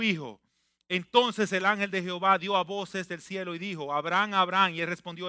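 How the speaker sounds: loud, solemn Bible reading; scripture reading. Acted. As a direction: proclaim the passage. Scripture read aloud in preaching. (lectura bíblica solemne)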